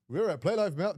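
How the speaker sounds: hammed-up imitation of the colleague's intro; mock announcer voice for the venue; impersonation of Monkey. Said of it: "I'll do my best impersonation".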